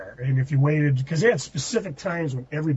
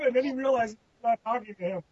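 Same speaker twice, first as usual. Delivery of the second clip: usual speaking voice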